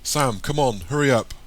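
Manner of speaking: pressing, lifted voice; urgent urging. A narrator's reading. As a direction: call out to hurry a child along. Urging child through escape hole.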